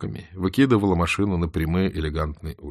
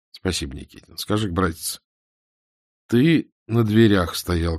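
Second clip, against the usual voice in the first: ordinary delivery, nothing marked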